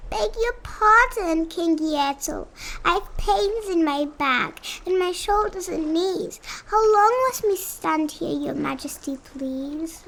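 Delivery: high, timid pleading voice; a small turtle begging his king; meek little turtle Mac. A narrator's reading. Turtle Mac pleading up to King Yertle, high and timid. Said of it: "this plain little turtle looked up and he said".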